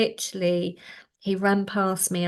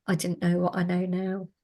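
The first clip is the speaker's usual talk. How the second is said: usual speaking voice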